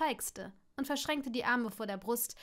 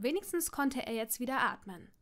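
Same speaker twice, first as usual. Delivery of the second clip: usual speaking voice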